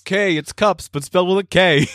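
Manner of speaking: high, put-on comic voice; Brooklyn mover. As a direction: explain the label as a daft mover. Mover bit continues.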